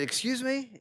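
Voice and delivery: high-pitched, taken-aback question; retold disbelief, pitch leaping up; incredulous. Patient answering an intrusive health-survey question, incredulous.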